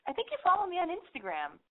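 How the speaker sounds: high, eager fangirl hello; nervous dorky hello to a singer; dorky greeting. Retold or imitated. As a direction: chirp it up like a starstruck dork. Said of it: "I go up to him like a dork".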